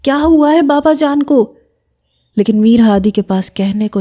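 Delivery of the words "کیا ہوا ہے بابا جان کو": high, frantic cry down the phone; screaming in panic. A narrator's reading. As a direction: shriek the question in panic. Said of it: "سارا زور زور سے چیخ رہی تھی".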